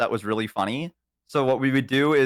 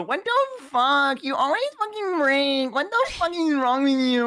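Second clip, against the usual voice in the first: high put-on voice scolding the microwave; cartoonish whiny outburst, much higher; squeaky fake fury at an appliance; mock exasperated rant; 13 semitones higher than usual. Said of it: "you go".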